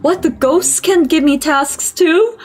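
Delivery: high, slow incredulous question; amazed. Real, not acted. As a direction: draw it out in rising disbelief. Amazed that ghosts give tasks.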